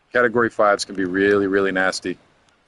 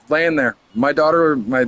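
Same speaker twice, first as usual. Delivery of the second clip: usual speaking voice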